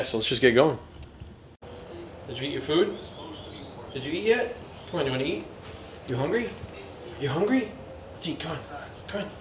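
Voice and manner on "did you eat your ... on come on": higher, softer coaxing pet voice. Real, not acted. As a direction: sweet-talk the dog into eating. Coaxing the dog to eat.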